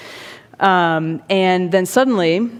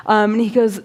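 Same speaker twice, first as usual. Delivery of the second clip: ordinary talk, nothing marked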